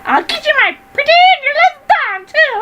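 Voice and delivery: high cackling witch voice; old hag. Acted. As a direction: threaten like the Wicked Witch. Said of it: "can I witch laugh".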